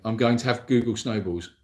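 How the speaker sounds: ordinary talk, nothing marked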